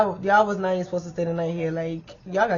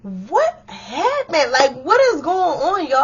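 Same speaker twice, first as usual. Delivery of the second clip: high, drawn-out bewildered question, getting louder; confused alarm; 8 semitones higher, 4 dB louder, slower than usual. Retold or imitated. Asking roommates what happened, high bewildered alarm. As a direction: stretch it out, totally lost. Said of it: "I'm so highly confused".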